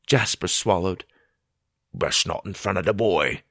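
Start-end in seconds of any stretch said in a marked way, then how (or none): none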